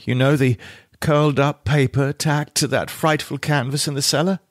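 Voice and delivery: ordinary delivery, nothing marked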